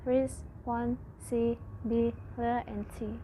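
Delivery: "ris, pon, si, bi, le, and ti" with a pause after each; syllable by syllable. Shown as a demonstration. Student teaching the class, splitting "ris, pon, si, bi, le, and ti" into separate syllables. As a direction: space out responsibility one syllable at a time. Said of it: "Responsibility has six syllables".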